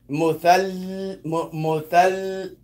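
"muthal" ending on a long sustained l; held lam. Shown as a demonstration. Teacher breaking down the word, held l in "muthal". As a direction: stretch the l at the end of "muthal". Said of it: "you hold the lam".

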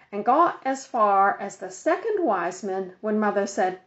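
plain reading voice

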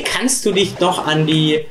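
normal talking voice

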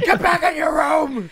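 high shouted order, half laughing; mock angry yell. Dad sending his kids back, mock shout.

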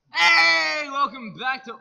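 hammed-up presenter intro; TV host shout. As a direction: announce it like a game show host. Mock show host greeting.

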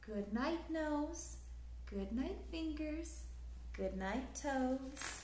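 ordinary delivery, nothing marked

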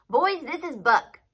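loud, high, slow introduction; announcing. A narrator's reading. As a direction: announce him to the whole camp. Colonel announces to his men.

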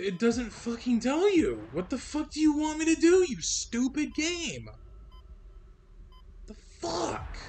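yelling at the game, pitch jumping high; furious outburst at a stupid game; real rage shouted up high; angry yelling. Real, not acted. Raging at the game.

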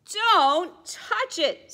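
high, loud, slow warning; mother penguin warning. Acted. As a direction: warn the little one sharply. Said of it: "says his mother".

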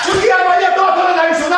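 shouting back in a heated quarrel; angry shout at his wife in a late-night row. Acted. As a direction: raise your voice at her, fed up.

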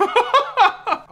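high, loud short laugh. Real, not acted. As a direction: crack up at your own bit. Laughs at bird bit.

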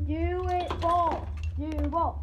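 loud urging. Real, not acted. Urging the challenge.

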